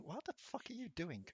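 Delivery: ordinary talk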